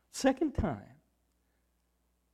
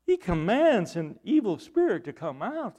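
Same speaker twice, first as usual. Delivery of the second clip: ordinary delivery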